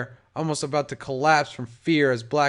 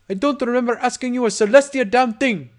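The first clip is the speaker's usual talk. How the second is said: angry yell; 8 semitones higher, 5 dB louder than usual. A narrator's reading. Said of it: "yelled in anger".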